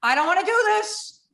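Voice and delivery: high pleading panic; panicked plea. Retold or imitated. Strapped in before the jump, a high frightened plea. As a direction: beg not to be let out. Retelling fear before jumping.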